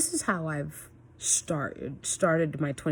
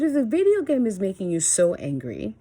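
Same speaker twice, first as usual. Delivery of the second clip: higher, louder retold reprimand; heated scolding; 5 semitones higher, 6 dB louder than usual. Mom re-enacting her first warning to gaming son, heated. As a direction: act out telling a child off firmly.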